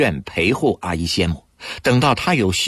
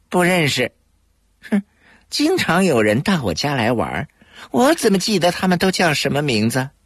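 sullen; 8 semitones higher than usual. A narrator's reading. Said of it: "脸一沉".

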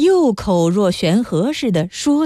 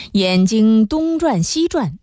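usual speaking voice

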